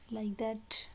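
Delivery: ordinary talk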